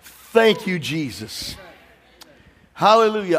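dropping to a hushed Jesus; relieved thanks. Retold or imitated. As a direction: sigh out thanks, then hush. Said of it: "hit the side of your car".

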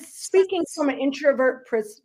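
ordinary talk

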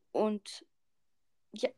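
talking with hiccups; hiccupping between words. Real, not acted. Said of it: "ups, ich hab Schluck auf".

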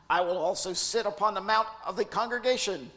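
a vain angel bragging to the sky; Lucifer's voice. Acted. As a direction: grandiose mock Lucifer. Climbing Lucifer boast.

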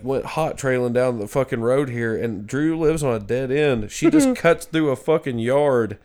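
ordinary talk

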